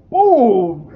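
very loud, high exclamation; explosive boom. Retold or imitated. Narrator imitating the football-style hit, booming shout. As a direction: shout it like a tackle landing.

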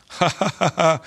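acted high, staccato laugh; bursting out laughing. A narrator's reading. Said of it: "Jolly Good Day burst out laughing".

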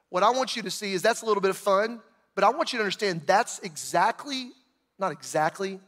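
ordinary talk, nothing marked

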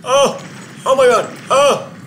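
loud, high shrieks of fright; startled scream. Real, not acted. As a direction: scream at the jump scare. Jump scare in game.

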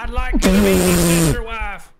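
loud southern holler; hammed-up southern accent. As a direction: belt it out in a mock southern accent. Parody southern incest joke.

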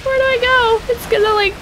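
high frightened squeal; panicky squeal. Real, not acted. Panicking as water floods in.

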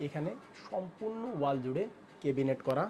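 soft, hushed presenting voice; speaking softly. Real, not acted. Host showing cabinets while baby sleeps, speaking softly. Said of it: "আস্তে আস্তে কথা বলছি".

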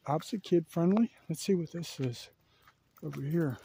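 usual speaking voice

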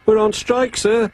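very high-pitched little engine; Percy the engine, defiant but nervous. A narrator's reading. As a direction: pipe up high to the boss.

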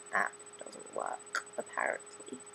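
whispered sad aside; hushed. Pretend. Teen sadly whispering about her iPod app. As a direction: drop to a whisper.

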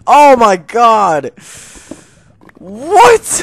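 very high, loud cry of disbelief; astonished shout. Real, not acted. Amazed by diamond placement.

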